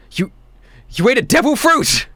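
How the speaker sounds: shocked dad, pitch jumping up; halting, then burst out in astonishment; stunned father's exclamation; very surprised. Acted. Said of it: "he seems very surprised".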